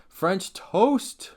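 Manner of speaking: high, loud proclamation; loud announcement. Acted. Ranker announcing the next item, loud and high. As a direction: announce it with a big flourish.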